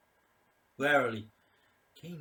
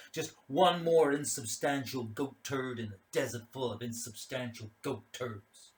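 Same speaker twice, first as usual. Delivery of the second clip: usual speaking voice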